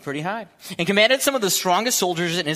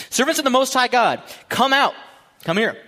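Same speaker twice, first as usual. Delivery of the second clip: higher, loud royal summons; shouting; 10 semitones higher, 6 dB louder than usual. Acted. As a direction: shout it into the fire. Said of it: "and shouted".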